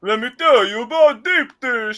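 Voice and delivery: hammed-up Italian accent, high and drawn out. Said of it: "that's my Italian accent".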